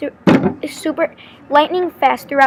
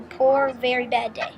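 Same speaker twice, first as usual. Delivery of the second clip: plain reading voice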